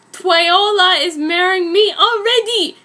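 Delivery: hammed-up booming claim. A narrator's reading. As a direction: boom it out like a jealous vampire. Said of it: "his voice was booming".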